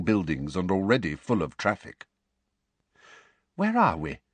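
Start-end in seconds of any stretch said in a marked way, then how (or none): 3.6-4.2 high, bewildered question; lost in the street; blank. A narrator's reading. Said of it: "said Mister Weasley blankly".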